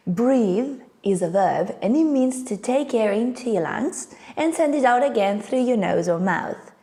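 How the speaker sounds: ordinary delivery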